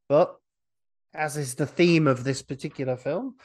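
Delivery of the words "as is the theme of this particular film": usual speaking voice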